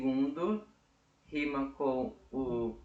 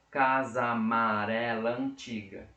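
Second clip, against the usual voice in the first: slow, louder syllable count; 4 dB louder, slower than usual. Shown as a demonstration. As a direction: sound out each poetic syllable slowly. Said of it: "Sílaba poética é aquilo que eu falo".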